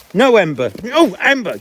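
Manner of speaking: loud, high exclamations at the dog; sharp dog scolding. Real, not acted. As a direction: call her off sharply. Dog jumps on owner.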